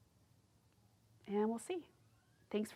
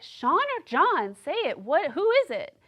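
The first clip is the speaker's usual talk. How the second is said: higher, insistent demand; exasperated; 9 semitones higher, 6 dB louder than usual. Retold or imitated. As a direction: push her to say it. Demanding clarification.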